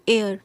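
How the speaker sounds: "एयर" said loudly, starting on the vowel; silent-h pronunciation. Shown as a demonstration. Teacher showing how to pronounce it, clear vowel-first "एयर". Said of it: "इसको कैसे pronounce करेंगे".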